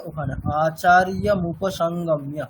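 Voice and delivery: ordinary talk, nothing marked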